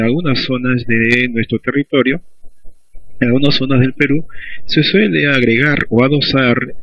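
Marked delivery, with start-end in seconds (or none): none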